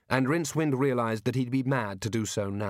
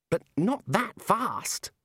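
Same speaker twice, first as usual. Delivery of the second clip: high, slow objection; Ponder protesting; 9 semitones higher, much slower than usual. Acted. Ponder argues with Ridcully, slow and high. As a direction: drag out the words in disbelief.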